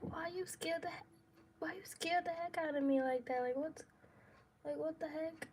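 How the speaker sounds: a touch of hushed, high-pitched protest; mild retold complaint. Woman asking partner why he scared her, mildly exasperated.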